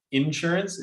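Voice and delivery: INSURANCE with the weight on IN; stress on IN. Shown as a demonstration. Co-host calls people out, stressing the IN. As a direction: lean on the first syllable: IN-surance. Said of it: "so much emphasis on the in".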